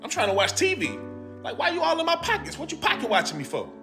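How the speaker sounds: ordinary talk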